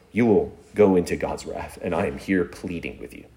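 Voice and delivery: low, soft, earnest plea; softened solemn pleading. Shown as a demonstration. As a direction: plead gravely, dropping low at each line end. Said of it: "you might have to say".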